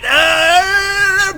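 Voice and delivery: sudden high yelp of shock; surprised shout. Real, not acted. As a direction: yell out in surprise. Battlecruiser dies, shout heard.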